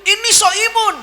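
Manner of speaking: high-pitched picking-a-fight exchange; comic high-pitched squabble; mock provocation. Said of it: "Dia mancing".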